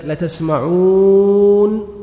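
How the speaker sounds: teacher slowly sounding out "لا تسمعون" for the class; slow spelled-out demo. Shown as a demonstration. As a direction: stretch "لا تسمعون" out so each letter is heard. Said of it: "إذا هي لا".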